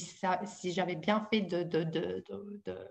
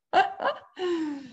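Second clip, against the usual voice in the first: short high-pitched laugh; 9 semitones higher than usual. Real, not acted. Speaker finishing her build-up to full presence, high laugh. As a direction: break into a brief, high laugh.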